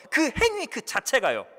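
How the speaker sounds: ordinary talk, nothing marked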